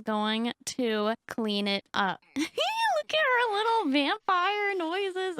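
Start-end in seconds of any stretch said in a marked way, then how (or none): 2.3-5.3 amused laugh. Real, not acted. Laughing at the hissing.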